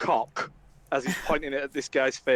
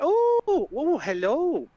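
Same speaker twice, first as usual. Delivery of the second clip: much higher, sing-song charm; flirty hello; 9 semitones higher, slower than usual. Acted. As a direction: turn on the charm suddenly.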